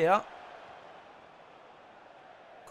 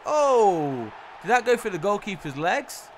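loud, high, drawn-out cry of astonishment; amazed outburst; 4 semitones higher, 6 dB louder than usual. Real, not acted. Commentator reacts to a shot through the keeper's legs, amazed. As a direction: burst out in delighted disbelief.

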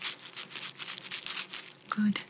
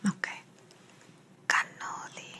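right in your ear; 7 dB quieter than usual. Acted. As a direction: close ear whisper. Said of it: "Now I'm going to whisper in each ear".

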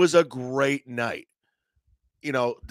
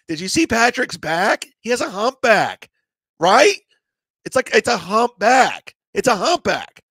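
excited gossip; 8 semitones higher, 5 dB louder than usual. Retold or imitated. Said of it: "Gino goes".

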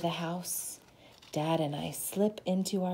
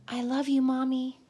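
put-on child's sweet goodbye; 6 semitones higher, 6 dB louder than usual. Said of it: "child's loving goodnight".

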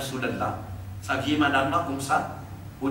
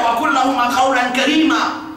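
Quran recitation; 4 semitones higher, 3 dB louder, faster than usual. Acted.

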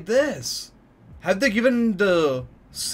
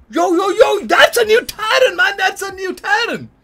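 excited; 14 semitones higher, 5 dB louder than usual. Real, not acted. New titan appears on screen.

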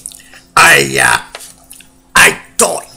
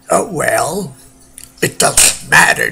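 ordinary delivery

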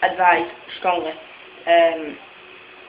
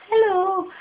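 high cheery hello; 11 semitones higher than usual. Real, not acted. Greeting a special friend.

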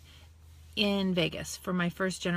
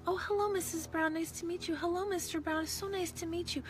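softer, high polite schoolgirl greetings; 9 semitones higher, 7 dB quieter than usual. Retold or imitated. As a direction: mimic well-mannered girls.

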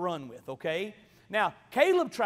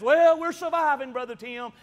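mock weary churchgoer; 2 semitones higher, 8 dB louder than usual. Mock congregant answering the pastor.

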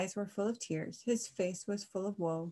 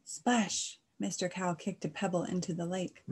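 plain reading voice

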